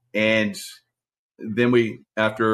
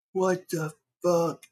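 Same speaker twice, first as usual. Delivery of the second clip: much higher, slow-drawn astonishment; imitated stunned disbelief; 10 semitones higher, 4 dB quieter, slower than usual. Teammate looking down from the helicopter, stunned disbelief.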